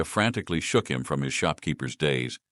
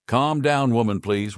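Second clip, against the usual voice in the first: calm down, said much higher and louder; raised plea; 7 semitones higher, 5 dB louder than usual. Acted. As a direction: shout it to break up the fight. Adventurer intervenes.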